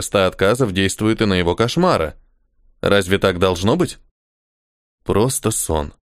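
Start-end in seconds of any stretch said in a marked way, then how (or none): 5.0-5.9 soothing. Acted. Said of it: "продолжал он успокаивать".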